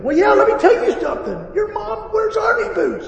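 put-on high, loud childish insult; mock comeback. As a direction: hurl a hammed-up insult right back. Acting out a yelled comeback.

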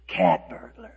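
low goofy voice, puzzled; dim listener. Pretend. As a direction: play a slow, puzzled guy. Voicing a confused listener.

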